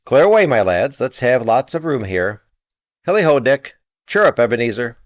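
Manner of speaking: loud, high cheerful orders; hearty shout. A narrator's reading. Fezziwig clearing the floor for dancing, loud cheerful shout. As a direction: get everyone moving, merrily. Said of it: "cried old Fezziwig".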